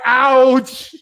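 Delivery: drawn-out "ow" as if stung; mock pained ouch. Recalling a joke that hurt, mock pained ouch. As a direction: yelp it out like a joke landed. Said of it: "he hit me where it hurt".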